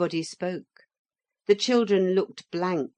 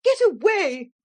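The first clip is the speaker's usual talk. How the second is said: high loud shriek at the bird; furious cry; 13 semitones higher, 8 dB louder than usual. A narrator's reading. Motor lady to the parrot, furious shriek. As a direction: flap it off in fury. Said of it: "she cried, flapping her hands furiously".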